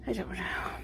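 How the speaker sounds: soft whispered shrug. Real, not acted. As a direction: whisper it half to yourself. Muttered aside to the cat.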